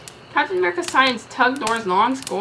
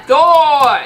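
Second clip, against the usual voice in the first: loud boastful shout; loud proud cry; 5 semitones higher, 10 dB louder than usual. Acted. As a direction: bellow the name. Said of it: "Thor shouted".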